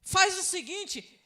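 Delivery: high, loud acted protest; imitating a man pleading his case loudly; insistent objection. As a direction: argue back urgently, pitched well up. Rich man's objection acted out.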